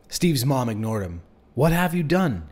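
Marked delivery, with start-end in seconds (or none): 1.5-2.5 high, louder accusing cry; a narrator's contained scream. A narrator's reading. Frantic mother shaking her son's friend, contained scream. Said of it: "she screamed again".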